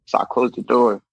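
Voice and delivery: ordinary talk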